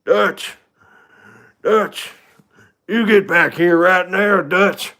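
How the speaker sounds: an outlaw yelling after his fleeing leader; Arthur Morgan impression. Shown as a demonstration. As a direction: yell after him across the camp. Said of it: "I can try an Arthur Morgan".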